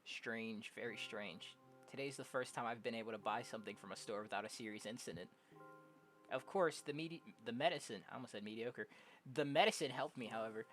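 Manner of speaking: normal talking voice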